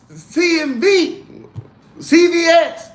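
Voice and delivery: high, slow letters spelled out; mock high spelling. Joking about robbing a coin, high mock spelling.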